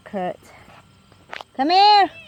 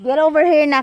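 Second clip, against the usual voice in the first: louder stern call over distance; calling from far away; 7 dB louder, faster than usual. Real, not acted. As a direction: call them back firmly.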